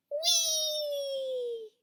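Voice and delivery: very high sustained squeal of joy; gleeful whee. A narrator's reading. Little rabbit squealing mid-jump, very high and gleeful. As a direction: squeal as she leaps. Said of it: "she jumped high into the air".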